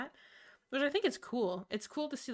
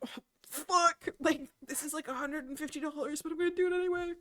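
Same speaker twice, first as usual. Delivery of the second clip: high-pitched torn fan outburst; mock agonized excitement; 7 semitones higher, faster than usual. Fan hearing of a pricey tour, mock agonized excitement. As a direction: act out helpless, overexcited caving in.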